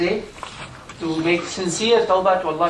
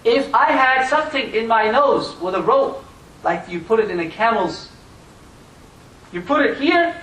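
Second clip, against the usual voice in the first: much higher, louder pleading cry; Sufyan's impassioned confession; 8 semitones higher, 6 dB louder than usual. Retold or imitated. Sufyan tells the people why he walks, impassioned cry. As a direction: cry out your guilt before the people. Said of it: "Sufyan said".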